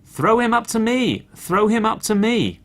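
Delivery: high, loud shout; the robber retells the rooster's high, loud shout. A narrator's reading. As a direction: yell it down from the roof. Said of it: "who shouted".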